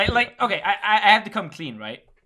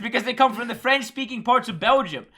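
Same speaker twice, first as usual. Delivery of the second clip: high-pitched, emphatic lecturing; insistent rant; 6 semitones higher, 3 dB louder than usual. Real, not acted. Dutchman claiming the right to rule on fries, insistent rant. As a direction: lay down the law, pitched up. Keeps ranting about fries.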